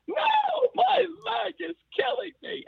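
retold scream. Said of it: "And she's screaming".

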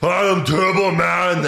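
high, loud, drawn-out superhero proclamation; Arnold. Acted. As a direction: announce yourself in a hammed-up action-hero voice. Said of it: "It's like Arnold in".

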